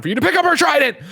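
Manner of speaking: high squeaky mock voice; high mock quote of the mother. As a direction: squeak it out as a mocking woman. Retelling movie scene, quoting mother.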